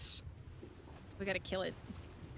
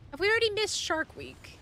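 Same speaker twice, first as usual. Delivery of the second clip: a lively, slightly raised ask about Shark Week; raised teasing ask; playful question; 8 semitones higher than usual. Pretend. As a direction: tease the question out. Repeats the question, pitch rising.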